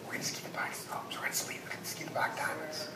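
hushed, eager whispering; excited whisper. Retold or imitated. Kids on the bus whispering about the hardest slopes. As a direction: whisper it like a thrilling secret. Youth group hyping each other up.

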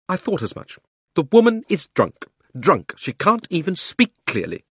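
high-pitched scandalised verdict; indignant aunt. Acted. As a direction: pronounce it high, with scornful certainty. Aunt declares the woman drunk.

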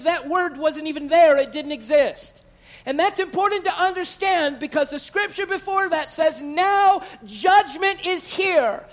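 loud, high, driving sermon voice; fiery pulpit preaching; preaching at full force. Acted. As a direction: preach it hard to the congregation.

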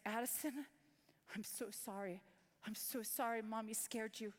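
put-on whisper-soft apology to a scared child; tearful apology. Mother apologizes to her son, soft and broken. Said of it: "I said".

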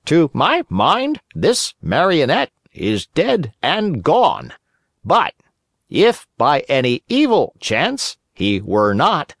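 high, slow, solemn crow voice; solemn crow doctor. A narrator's reading. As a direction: pronounce it like a pompous crow doctor. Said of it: "the crow ... solemnly pronounced".

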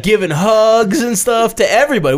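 normal talking voice

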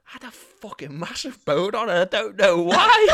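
high-pitched squeal building to a shout; hammed-up baffled confession, breaking into laughter; mock bewildered outburst. Quotes the lad's bewildered confession, laughing.

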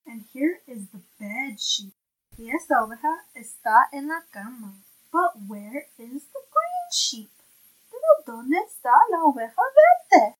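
bright, clear picture-book reading; reading to a toddler. Acted. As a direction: read it aloud to a little one. Parent reads picture book aloud.

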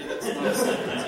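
loud, high, laughing through it; laughing retort. Retold or imitated. Pastor answering his mom, loud laughing retort. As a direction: crack up as you answer. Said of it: "I'm like".

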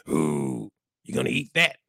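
low ghostly moan turning to a hungry question; overweight ghost. Pretend. Overweight ghost asking about your food, low moaning. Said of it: "he was like".

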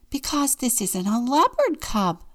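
a touch of distress; tearful. A narrator's reading. Mama explains to the vet, mildly tearful. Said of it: "said Mama tearfully".

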